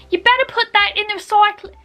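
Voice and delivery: put-on fast, high Australian accent; attempted Australian accent. Quick-fire quote retried for viewers, fast Australian accent. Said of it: "I'm so sorry".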